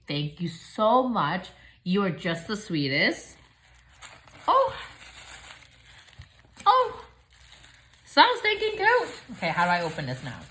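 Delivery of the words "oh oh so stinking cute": high squealing gush; high cooing squeal; gushing over cute. Real, not acted. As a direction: coo over something adorable. Seeing cute bows.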